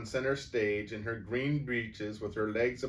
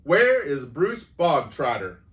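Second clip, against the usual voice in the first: much louder, higher, slow bark; bellowing; 6 semitones higher, 13 dB louder than usual. A narrator's reading. Headmistress summoning a boy, loud slow bellow. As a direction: roar it to the whole school.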